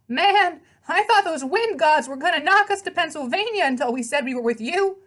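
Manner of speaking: high, brash horse voice with a street twang; pegasus voice. Acted. The pegasus greets his rider in a high, brash horse voice. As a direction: play the cheeky winged horse, all swagger.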